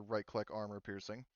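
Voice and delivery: normal talking voice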